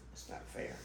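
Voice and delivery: a whispered it's not fair; whispered complaint. Real, not acted. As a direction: whisper it, breathy and unvoiced. Tired complaint about lost sleep.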